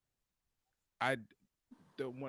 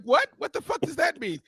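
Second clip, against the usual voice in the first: incredulous; 10 semitones higher, 5 dB louder than usual. Retold or imitated. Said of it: "I was like".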